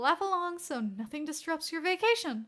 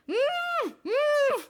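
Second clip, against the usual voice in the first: high muffled "mm, mm" through a gag; gagged squeals of protest; 5 dB louder than usual. Acted. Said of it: "Naomasa protested".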